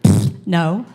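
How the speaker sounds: loud scoffing pfft; dismissive brush-off. Retold or imitated. Turning down the cool girls' invitation, loud scoff. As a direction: blow them off with a scornful no. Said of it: "And I'm like".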